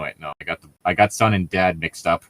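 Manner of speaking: usual speaking voice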